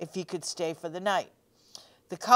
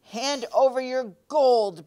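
slow, loud, higher mock-fierce demand; fierce bellow; 7 semitones higher, 6 dB louder than usual. A narrator's reading. Poor man demanding gold from wee folk, mock-fierce bellow. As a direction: boom it out, trying to sound fierce. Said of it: "bellowed Tim, trying to be fierce".

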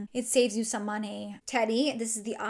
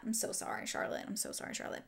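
sheepish, much softer whispered sorry; whispered apology; 9 dB quieter, faster than usual. Real, not acted. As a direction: whisper an apology after teasing her. Apologizing to Charlotte after imitation.

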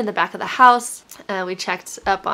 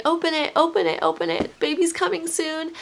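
put-on higher, eager little girl urging; Scout's excited voice; 8 semitones higher than usual. Mother quoting her young daughter at the birth, higher eager child voice. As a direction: mimic a small child cheering the birth on. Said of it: "I remember she's telling me".

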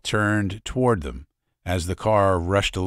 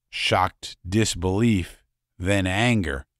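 usual speaking voice

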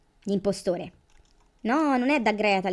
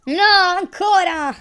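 long, loud, higher wail of frustration; frustrated cry; 9 semitones higher, 9 dB louder, slower than usual. Real, not acted. Player losing again cries out, loud and slow. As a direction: groan out the loss, drawn long. Fails the impostor game again.

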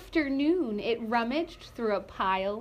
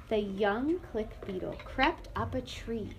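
ordinary delivery

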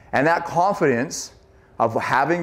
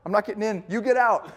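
yelling at friend; 11 semitones higher than usual. Retold or imitated. A friend yelling at his best friend to leave the water, high and urgent. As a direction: yell to save your buddy. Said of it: "yelling at your best friend".

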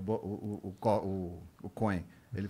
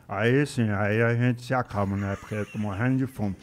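Maguila's voice; 6 dB louder than usual. Retold or imitated.

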